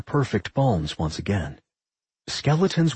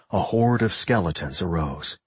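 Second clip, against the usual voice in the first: ordinary delivery, nothing marked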